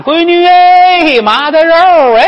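long, loud, high-pitched holler; doting call stretched way out; calling up from downstairs. Acted. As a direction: sing it out to someone upstairs. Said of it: "打楼底下就喊".

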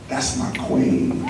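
low, soft and slow; hushed, let each word land tenderly; tender reverence. Retold or imitated. Telling her friends what she is to him, hushed and tender. Reveals his climactic reverent answer.

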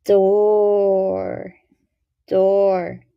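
Door, door: stretched then blended; sounding out words. Shown as a demonstration. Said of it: "Listen as I blend the sounds together".

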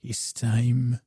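show announcer. Acted.

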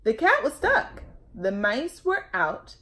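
put-on lively, loud read-aloud for children; storytelling read-aloud. A narrator's reading. Librarian reading a picture book to kids, lively.

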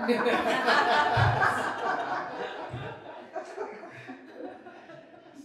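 big loud burst of laughter. Real, not acted. Laughs at her accent joke.

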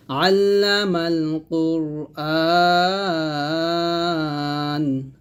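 slow chant with the last "আ" of "কুরআন" held very long; long madd. Shown as a demonstration. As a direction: stretch the end of "কুরআন" for three alifs. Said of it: "আতে তিন আলিফ টান দিয়ে".

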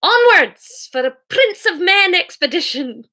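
hearty storybook bellow, punching the first word. Acted. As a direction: bellow it into the distance. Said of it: "bellowed Hamish into the distance".

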